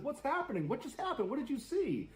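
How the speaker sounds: high, frantic pleading questions; panicked questions. Retold or imitated. Terrified daughter begging her mom to explain. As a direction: voice shooting up in panic.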